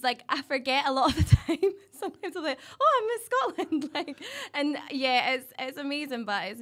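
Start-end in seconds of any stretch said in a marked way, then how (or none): 2.8-3.9 high-pitched mock surprise; as if it has just dawned on you; sudden realisation. Pageant winner remembers her new title, mock surprise.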